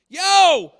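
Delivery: high, very loud shout; confrontational call. Acted. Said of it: "This was that confrontation".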